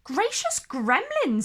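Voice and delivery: a touch of a gasp of surprise; surprised. A narrator's reading. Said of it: "in surprise".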